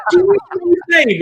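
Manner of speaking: high-pitched accusing imitation; mock indignant question. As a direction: grill them as the outraged groom. Groom interrogating, retold.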